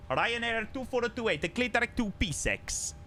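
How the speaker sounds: high-pitched Italian controller; Italian accent. Acted. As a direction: read the clearance in a put-on Italian accent. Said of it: "an Italian accent".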